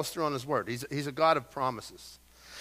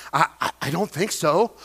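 stumbling hesitation. Retold or imitated. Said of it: "I get the stumbling effect".

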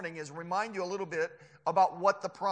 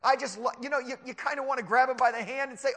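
ordinary talk

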